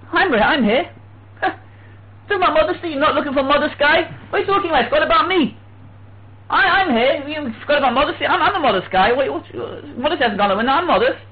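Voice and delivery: high-pitched, put-out objection; mock indignant protest. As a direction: act affronted at being overlooked. Acts out sage protesting his modesty.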